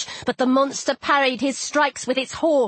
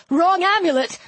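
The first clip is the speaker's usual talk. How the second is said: mild, raised-pitch yell; 4 semitones higher than usual. A narrator's reading. Walt explaining his mistake, mildly yelled. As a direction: call out the excuse, pitched up. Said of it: "he yelled".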